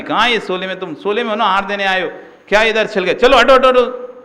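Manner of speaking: high, loud scolding yell at a newcomer; angry scolding. Retold or imitated. Sadhu yells at a newcomer who pushed in, loud and angry. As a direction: shout at him for barging in. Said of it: "ऐसे चिल्ला देते थे".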